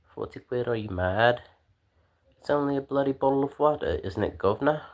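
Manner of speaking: high-pitched, hammed-up British indignation; British accent. Streamer haggling over bottled water in a mock British accent. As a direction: protest the price in a put-on British accent. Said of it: "My best British accent".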